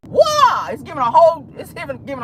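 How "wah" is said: high, loud karate battle cry; Bruce Lee kiai. Retold or imitated. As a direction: let out a kung fu yell, pitched way up. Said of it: "that's what Bruce Lee used to say".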